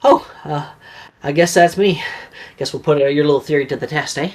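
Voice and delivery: lightly voiced cocky boy; a touch of a teasing lad; Morgan's voice. Morgan teasing Galileo about his theory, lightly voiced. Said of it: "he says to Galileo".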